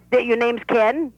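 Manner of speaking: put-on lively old Brooklyn bubby; Bubby's voice. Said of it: "This is the Bobby talking".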